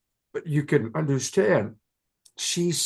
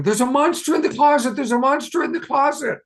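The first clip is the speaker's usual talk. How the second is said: much higher, much louder panicked scream; child screaming in fear; 9 dB louder, faster than usual. Acted. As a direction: scream like a terrified five-year-old at night. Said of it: "she's screaming".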